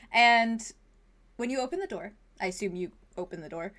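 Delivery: ordinary delivery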